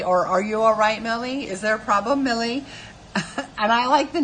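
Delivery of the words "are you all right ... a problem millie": higher, lilting fake concern, name lifted; mock condescending. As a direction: needle them with patronizing questions. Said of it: "condescending way".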